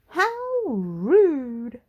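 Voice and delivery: high, loud, indignant lady's exclamation; Little Miss Splendid exclaiming. Acted. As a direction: gasp it out as a shocked prim lady. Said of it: "she exclaimed".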